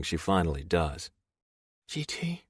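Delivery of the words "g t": soft voice. Acted. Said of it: "Her voice is soft".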